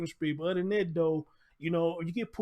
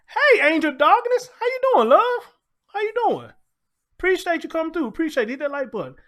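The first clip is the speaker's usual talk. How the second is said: much higher and louder, gushing welcome; hype shoutout; 11 semitones higher, 10 dB louder than usual. Real, not acted. Streamer greets a chat member, high and excited. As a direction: greet them like your biggest fan just walked in.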